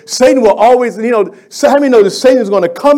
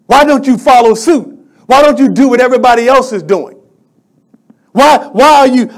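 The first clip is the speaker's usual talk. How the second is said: louder, higher indignant demand; angry king; 5 semitones higher, 6 dB louder than usual. Acted. Nebuchadnezzar demanding the Hebrew boys conform, angry and louder. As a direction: bark it like a furious king. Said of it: "in a rage and fury, he got mad".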